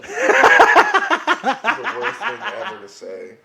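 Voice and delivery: slow, loud and high, laughing; laughing, mock-exasperated; laughing outrage. Real, not acted. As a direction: drag it out through laughter.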